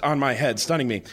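normal talking voice

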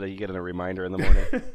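giggling through the words; laughing. Real, not acted. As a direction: laugh as you say it. Laugh heard while speaking.

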